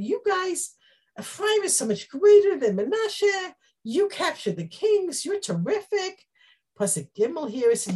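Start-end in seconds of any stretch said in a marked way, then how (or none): 0.0-6.2 higher, gushing flattery; butter them up eagerly; flattering praise. Retold or imitated. Gideon stroking Ephraim's egos, gushing with praise. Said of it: "he says to them".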